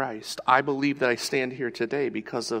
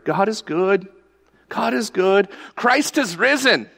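louder and higher, building to Christ has risen; joyful proclamation; 7 semitones higher, 4 dB louder than usual. Acted. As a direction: proclaim it to the church like good news. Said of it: "let that announcement of God be made".

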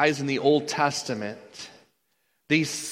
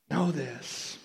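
much softer, hushed KNOW THIS; soft repeat; 7 dB quieter than usual. Real, not acted. Preacher urging listeners to know God's love, hushed. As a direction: say "Know this" softly, letting it sink in.

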